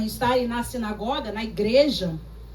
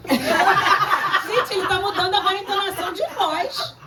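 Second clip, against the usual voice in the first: real laughter breaking through the words; can't stop laughing at the pastor's tone; giggling through the line; laughing while talking; 4 semitones higher than usual. Real, not acted. Laughing at brother's new tone.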